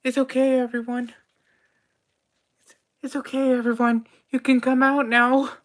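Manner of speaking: high, loud all-clear call. Acted. Head researcher calls the hidden staff out, high and loud. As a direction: shout to the hidden staff that it's safe. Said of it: "she calls out the rest of the room".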